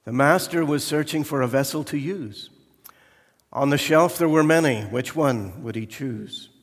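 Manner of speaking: poem read aloud with rising force; poem recital. Acted. Preacher reciting a poem to the congregation, building up.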